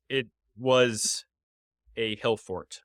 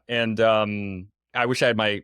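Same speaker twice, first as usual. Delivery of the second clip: ordinary talk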